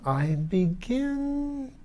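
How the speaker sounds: drawn out and fading as it dawns. A narrator's reading. Lord realizes he hated his wife, drawn out. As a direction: stretch it, rising and fading away.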